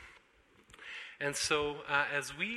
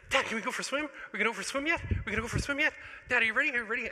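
put-on high, pleading child's voice, louder; eager kid; 9 semitones higher, 5 dB louder, much faster than usual. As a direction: pester Dad like an excited little boy. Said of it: "And Toby's like".

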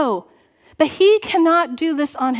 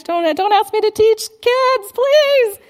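higher, louder whiny plea not to be picked; reluctant congregant pleading; 8 semitones higher, 6 dB louder, slower than usual. Retold or imitated. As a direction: beg in mock panic not to teach kids. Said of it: "So if you're like".

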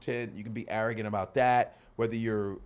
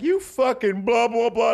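mock angry comic; 10 semitones higher, 6 dB louder, slower than usual. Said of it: "I was like".

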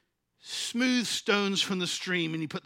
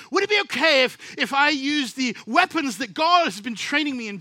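preaching; 4 semitones higher, 7 dB louder than usual. Acted. Preaching at full force.

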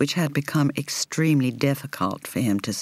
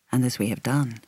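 plain reading voice

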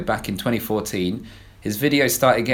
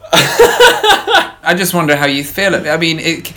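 laughing; 7 semitones higher, 6 dB louder than usual. Real, not acted. Laughing through an awkward question.

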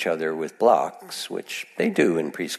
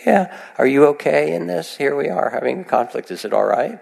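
higher-pitched, questioning inner voice; checking in with oneself; 7 semitones higher than usual. Acted. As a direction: ask it as if checking on yourself after a breath. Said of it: "take a bigger breath and you say".

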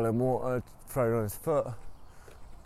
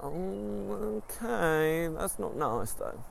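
high put-on wife voice, slowing; lightly hurt, airy and high; wife's voice; 8 semitones higher, much slower than usual. Wife answers husband's confession, high mock-hurt.

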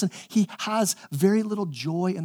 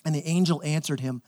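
ordinary talk

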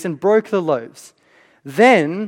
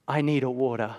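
put-on a small child's soft, lower plea at bedtime; sleepy child asking; 6 semitones lower, 9 dB quieter than usual. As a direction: play the kid who got out of bed, quietly. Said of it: "the words you never want to hear after eight P M".

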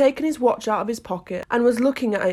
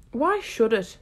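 ordinary delivery, nothing marked

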